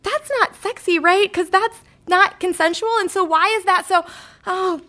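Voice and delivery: a hint of a baffled preteen; confused twelve-year-old. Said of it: "my twelve-year-old brain is like".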